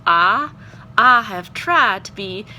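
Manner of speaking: drawn-out Southern "Ah" for "I"; Southern drawl, flattening "I" to "Ah"; Southern accent. Shown as a demonstration. Teacher quoting her student's script, Southern accent. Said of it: "have to have a Southern accent".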